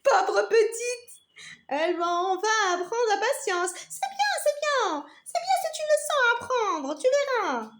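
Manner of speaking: high, laughing mock pity; sarcastic. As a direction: sneer in fake sympathy. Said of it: "La tomate sarcastique".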